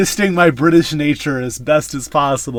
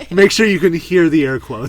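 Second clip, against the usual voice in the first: ordinary delivery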